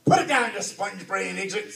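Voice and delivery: loud, irritated pirate captain; exasperated berating. A narrator's reading. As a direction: snap at a foolish underling. Captain berates his dim mate.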